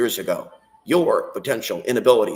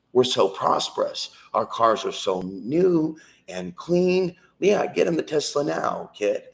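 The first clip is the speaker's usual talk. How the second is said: mocking tone; 6 semitones higher, slower than usual. Mocking prosperity gospel voice.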